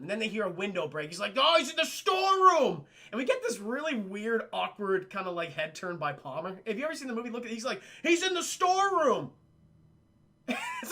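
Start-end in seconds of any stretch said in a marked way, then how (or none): 1.4-2.8 high, loud, hammed-up cry of alarm; imitating a movie character's shout; mock alarmed yell. Acted alarm in film scene. 8.0-9.3 high, loud, hammed-up cry of alarm; imitating a movie character's shout; mock alarmed yell. Repeats character's yelled line.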